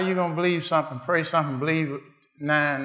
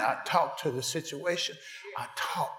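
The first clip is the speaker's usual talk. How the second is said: soft intimate preaching; 7 dB quieter than usual. Real, not acted.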